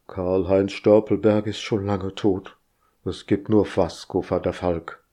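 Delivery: ordinary delivery, nothing marked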